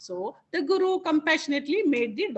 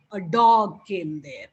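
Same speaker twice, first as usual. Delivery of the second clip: scared cat voice; 8 semitones lower, 4 dB louder, slower than usual. Acted. Cat telling the guru a dog came, low and slow. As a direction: drop low and slow, a frightened little cat.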